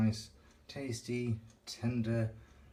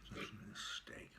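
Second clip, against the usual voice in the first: whispered; 3 semitones lower, 11 dB quieter than usual. Real, not acted. Eating on camera.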